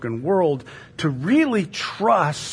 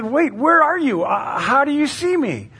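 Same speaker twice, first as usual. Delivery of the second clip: high, raised, bewildered voice; puzzled calling out; 10 semitones higher, 3 dB louder than usual. Acted. Hiker searching for the unseen voice, puzzled calling. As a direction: call up in confusion, acted.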